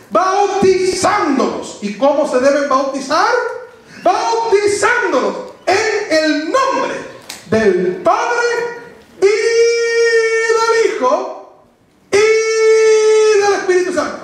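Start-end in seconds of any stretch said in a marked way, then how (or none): 0.1-1.8 BAUTIZÁNDOLOS drawn out slowly and pitched high; slow, high, emphatic BAUTIZÁNDOLOS; emphatic scripture quote. Real, not acted. Preacher intoning scripture with emphasis. 4.0-14.2 slow, raised, each phrase set apart with a long high Y; emphatic preaching. Real, not acted. Stresses each part of Trinity formula.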